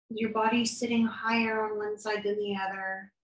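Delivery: calm, soft guided meditation voice, unhurried with long pauses; guided body scan. Acted. Therapist guiding a listener through a body scan, calm and soft. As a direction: lead them gently through the body.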